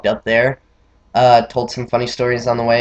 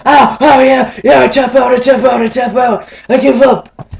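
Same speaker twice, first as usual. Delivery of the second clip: much higher, louder panicked "I tap out"; friend giving up; 10 semitones higher, 6 dB louder than usual. Retold or imitated. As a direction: squeal out the surrender in a high voice. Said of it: "And he's like".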